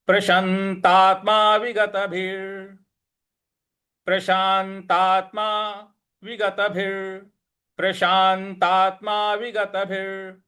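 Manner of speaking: light stress on शांत and तात; model recitation. Shown as a demonstration. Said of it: "इस प्रकार से बोलेंगे". (प्रशांतात्मा में हल्का बल)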